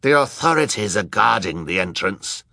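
lightly voiced character in the crowd; a hint of a put-on man's voice; man with a beard. A narrator's reading. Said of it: "the man with the beard but no hair".

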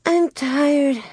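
high-pitched, tired little complaint; weary sighing child. A narrator's reading. Tired Carrie on the jolting board, sighing. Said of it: "Once Carrie sighed".

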